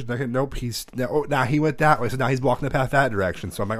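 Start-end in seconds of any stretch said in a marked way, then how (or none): none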